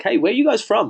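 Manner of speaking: high-pitched eager icebreaker; eager greeting. Acted. Traveler greeting strangers in another country, eager. Acting out chat with a stranger abroad.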